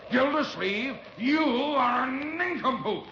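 high, slow, drawn-out insult; exasperated scolding. Acted. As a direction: spell out your outrage word by word. Scolds him for dropping rope.

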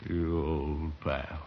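low, slow, drawn-out tenderness; sentimental affection. Acted. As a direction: get misty over your old pal. Answers sentimental farewell.

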